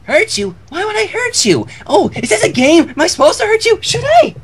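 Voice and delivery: high, bouncy, eager pony voice; bubbly Pinkie Pie. Acted. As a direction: squeak it out, thrilled to play a game.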